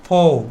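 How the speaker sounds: "ফো" ending on an open vowel, no R; silent final R. Shown as a demonstration. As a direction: say "ফো" as a long "foh" for four. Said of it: "কি বলবো?".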